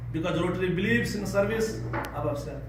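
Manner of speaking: usual speaking voice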